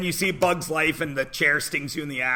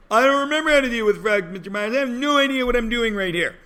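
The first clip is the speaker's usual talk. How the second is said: higher, fast helpless student voice; mock whiny student; 6 semitones higher, faster than usual. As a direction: play the student who gives up on fractions. Mocking a lost student.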